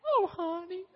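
high laughing coo as the wife. Retold or imitated. Wife comforting her confessing husband, high laughing coo. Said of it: "old Cindy goes".

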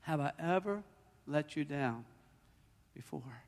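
very soft, low, with long pauses; comforting divine voice. Retold or imitated. The voice answering a frightened sick man, hushed. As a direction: let each phrase fall away into a hush. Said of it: "I heard the voice say".

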